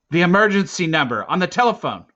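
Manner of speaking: loud, high-pitched exasperation; incredulous officer. A narrator's reading. Officer explaining nine one one to a boy, loudly incredulous. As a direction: spell out the obvious in disbelief. Officer stunned by boy's ignorance.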